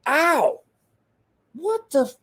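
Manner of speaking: high mock ow of pain; retold yelp of pain. Recalls sudden pain in arm.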